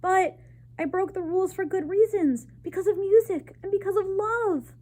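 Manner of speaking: high, loud and pleading. A narrator's reading. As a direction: raise your voice in defence of love. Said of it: "He raised his voice".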